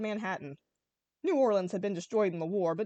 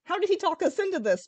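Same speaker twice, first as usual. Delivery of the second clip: higher and louder indignant question; demanding; 9 semitones higher, 5 dB louder than usual. A narrator's reading. Spyro demanding answers from his friends, indignant. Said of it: "Spyro demanded".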